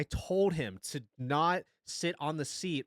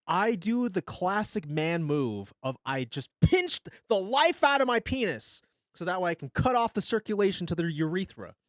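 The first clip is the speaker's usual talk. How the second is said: ordinary talk, nothing marked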